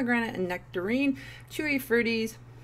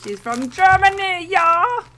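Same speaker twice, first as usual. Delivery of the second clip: a light Southern twang, louder, on the German grandma line; wrong Southern accent; 12 semitones higher, 14 dB louder, slower than usual. Pretend. Joking about German grandmas, a mild mock Southern twang. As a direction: slip into a hint of Southern drawl by mistake. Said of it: "I know, wrong accent".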